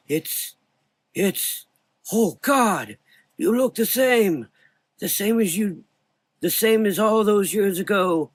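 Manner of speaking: put-on high, loud, trembling old voice; exclaiming old man. A narrator's reading. Old doctor exclaims at Frank's unchanged face. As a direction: cry out in awe and grief. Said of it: "Humes exclaimed".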